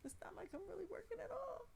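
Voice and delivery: high secretive whisper. Real, not acted. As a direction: confide it in a whisper. Whispered confession about working.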